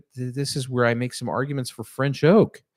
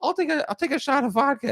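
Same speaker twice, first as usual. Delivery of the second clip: high friend voice; 13 semitones higher than usual. Retold or imitated. Friend quoted in story.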